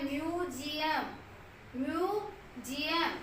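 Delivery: म्यूजियम very slow, long ई and ऊ; slow demo word. Shown as a demonstration. Teacher slowly models म्यूजियम with long vowels. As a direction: stretch both vowels of म्यूजियम. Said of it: "हम ऊ भी long बोल रहे हैं".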